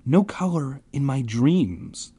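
usual speaking voice

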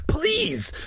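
very high, drawn-out plea; desperate plea. A narrator's reading. Boy caught in current begs for rescue, desperate. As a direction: beg at the top of the pitch range. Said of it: "his eyes looked wild and dazed".

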